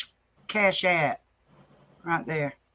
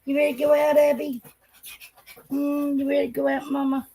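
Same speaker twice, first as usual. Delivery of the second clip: higher-pitched coaxing pet voice; teasing dog talk; 5 semitones higher, faster than usual. Real, not acted. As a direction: wind each dog up for going outside. Asking each dog if it's ready.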